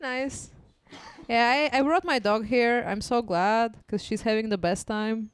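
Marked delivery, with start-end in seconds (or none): none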